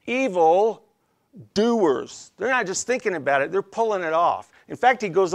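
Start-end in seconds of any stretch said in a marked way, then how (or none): 0.0-2.3 "Evil", a pause, then a punched "doers"; stress on DOERS. Shown as a demonstration. Preacher showing the congregation the emphasis, "doers" set apart and stressed. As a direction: split it and lean hard on "doers". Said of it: "the emphasis on that word is doers".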